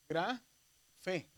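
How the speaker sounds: GRA and FE split by a pause; sounded out slowly. Shown as a demonstration. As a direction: read "Grafe" slowly in two separate syllables. Teacher sounding out a new word.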